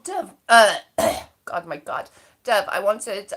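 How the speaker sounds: ordinary talk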